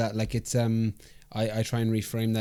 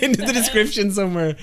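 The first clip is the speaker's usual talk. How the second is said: laughing; 8 semitones higher, 3 dB louder than usual. Real, not acted. Host amused by guest's line.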